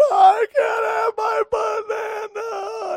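put-on squealing child. Preacher imitating his son wailing over no banana, high squealing wail. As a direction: wail it like a kid losing his bedtime treat. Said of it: "he squealed, he wailed".

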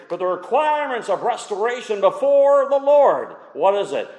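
emphatic preaching. Real, not acted. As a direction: hammer out the contrast like a preacher. Preaches permanent against temporary.

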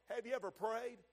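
ordinary talk, nothing marked

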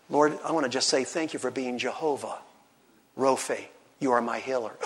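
low, soft, tender thanks to God; hushed, grateful prayer; sincere prayer. Shown as a demonstration. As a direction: pray it quietly and intimately.

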